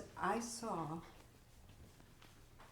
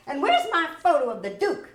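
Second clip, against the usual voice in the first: bossy shout; 6 semitones higher, 11 dB louder, faster than usual. Acted. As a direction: bark it at the gawking new girl. Boss demanding her photo.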